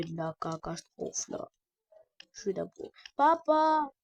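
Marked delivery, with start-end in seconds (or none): 3.2-3.9 high, loud call for dad; play-acted summons, raised voice. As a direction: shout for papa from another room. Calling papa in pretend play.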